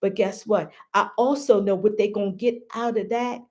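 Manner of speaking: usual speaking voice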